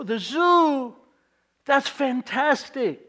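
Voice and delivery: playing up delight for a child; mock-excited. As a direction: gush back at the kid like she told you big news.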